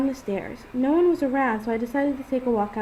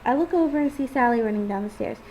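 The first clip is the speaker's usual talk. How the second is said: ordinary delivery, nothing marked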